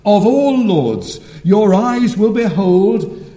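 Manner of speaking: slow, stately pulpit proclamation; fervent preaching. Real, not acted. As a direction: ring out each royal title, drawn out and building in force. Preaching the coming King.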